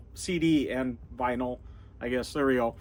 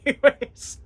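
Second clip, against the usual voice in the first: laughing; 10 semitones higher, slower than usual. Real, not acted. Rambling host amused at his own tangent, laughing. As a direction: say it while breaking up laughing.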